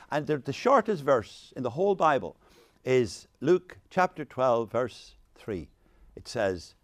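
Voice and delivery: ordinary talk, nothing marked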